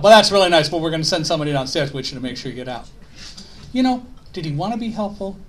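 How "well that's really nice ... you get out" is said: imitated New York firefighter, loud start then rushed; quick, insistent New York accent; heavy New York accent. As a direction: barge in with fast New Yorkese. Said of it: "in this heavy New York accent".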